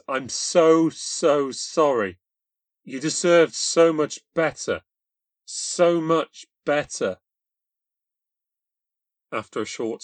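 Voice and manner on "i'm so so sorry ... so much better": slow, high, aching apology; begging her forgiveness, close to crying; tearful. A narrator's reading. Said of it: "with my eyes filling with tears".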